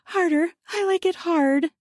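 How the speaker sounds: high, urgent moaned words; moaning urgency. Acted. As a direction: push the words out on rising moans. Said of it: "I moaned".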